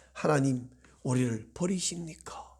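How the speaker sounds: low, soft appeal to God; subdued acted prayer; hushed plea. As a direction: plead quietly, dropping right down. Acts out the believer's plea.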